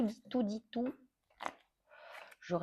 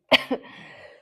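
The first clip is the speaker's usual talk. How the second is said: rough cough after choking; 6 dB louder than usual. Real, not acted. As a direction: clear the throat harshly. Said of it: "J'ai fait une fausse route".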